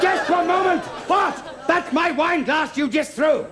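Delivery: high, outraged yell; indignant shout. Acted. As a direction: explode at a colleague over his carelessness. Acted quarrel over thrown glass.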